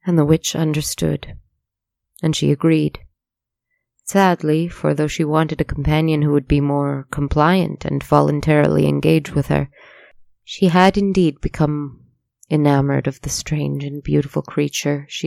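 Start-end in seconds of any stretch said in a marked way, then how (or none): none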